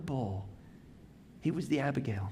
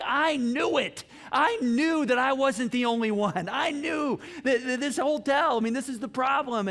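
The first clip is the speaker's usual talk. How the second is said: much higher, louder triumphant burst; vindicated outburst; 7 semitones higher, 4 dB louder than usual. Retold or imitated. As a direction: crow it like you were proven right.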